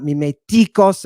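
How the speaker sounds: MIMETIKOS stressed on the third syllable, TI; misplaced accent. Shown as a demonstration. Teacher showing another wrong Greek accent, stress on third syllable. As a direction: punch the TI in MIMETIKOS. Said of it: "not mimetikos".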